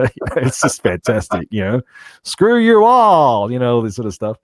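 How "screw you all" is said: put-on high, loud, drawn-out Alabama accent; Alabaman character. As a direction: holler it slowly as a hammed-up Alabaman. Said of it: "the Alabam-, Alabaman character".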